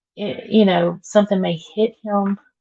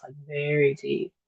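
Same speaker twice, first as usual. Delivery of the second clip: ordinary talk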